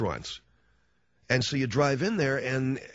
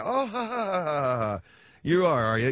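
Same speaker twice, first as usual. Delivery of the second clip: mocking laugh; 4 semitones higher than usual. Hostess laughs at him.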